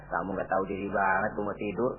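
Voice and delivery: muttered very low and soft under the breath; inner grumble. Acted. Tired host silently grumbling about an unwelcome guest, muttered low. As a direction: grumble it quietly to yourself. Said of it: "Mestinya kan hati kita".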